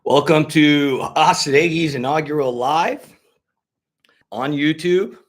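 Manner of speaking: loud, high, drawn-out show opening; grand welcome. Real, not acted. Host opens a first YouTube livestream, grand welcome.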